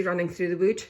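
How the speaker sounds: plain reading voice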